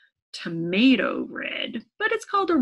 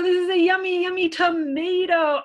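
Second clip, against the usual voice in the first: higher and louder, gushing over it; excited delight; 7 semitones higher, 7 dB louder than usual. Acted. Teacher delighting children over the tomato, higher and louder. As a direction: sell how yummy it is. Excited reveal of riddle answer.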